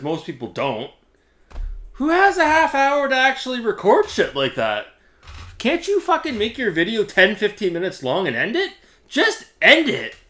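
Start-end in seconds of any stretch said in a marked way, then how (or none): 1.9-10.2 higher, heated and fed up; exasperated rant. Real, not acted. Vlogger rants at the rival about his long videos, exasperated. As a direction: vent at him like you've had enough.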